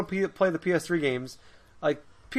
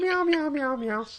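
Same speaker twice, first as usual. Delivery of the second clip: high-pitched playful meows; cat meowing; 11 semitones higher, slower than usual. Pretend. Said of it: "That sounded like Bu".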